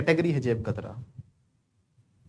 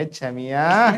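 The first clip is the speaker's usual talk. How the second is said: mock street tease; 4 dB louder, much slower than usual. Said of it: "लड़के बोलते हैं".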